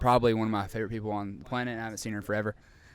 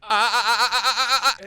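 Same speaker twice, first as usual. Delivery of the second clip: high, loud stuck "ah ah ah" with laughter; stuttering stuck sound; 10 semitones higher, 8 dB louder than usual. Retold or imitated. Retelling being called on in class, stuck stammer. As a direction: reenact a stutter freezing on one sound. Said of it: "this is what comes out".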